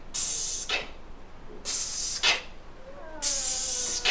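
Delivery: long sharp hiss cut off with a click; monster's hiss. Acted. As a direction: hiss it out, then click shut. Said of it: "He'll say".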